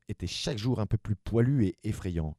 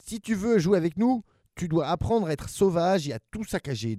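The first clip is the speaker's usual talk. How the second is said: put-on high, loud bossy kid voices; bossy big siblings; 8 semitones higher, 4 dB louder than usual. Big siblings bossing their baby brother, high and loud. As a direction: lay down the rules like bratty monsters.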